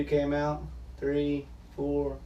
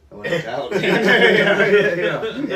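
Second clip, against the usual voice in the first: higher, amused quote breaking into laughter; laughing punchline; 9 semitones higher, 11 dB louder, much slower than usual. Retold or imitated. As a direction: let the laugh break into the retold line.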